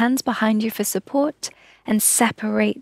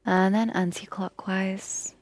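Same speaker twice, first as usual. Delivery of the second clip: whispered guidance; 4 dB quieter than usual. Acted. Yoga teacher guiding neck circles.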